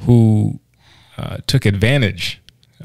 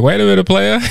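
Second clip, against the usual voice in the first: personified furlough; 12 semitones higher than usual. Acted. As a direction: call out playfully as a furlough stopping someone. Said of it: "A furlough was like".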